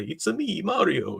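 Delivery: put-on quick high Italian-accent Mario catchphrase; Mario's Italian accent. Streamer quoting Mario's catchphrase to his chat, in a playful Italian accent. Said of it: "he's got that accent".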